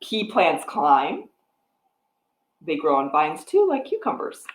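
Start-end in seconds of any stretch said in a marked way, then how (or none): none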